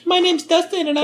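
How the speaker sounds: very high, wimpy mimicry; mock-dainty squeak; mocking imitation. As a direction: tease in a silly high voice.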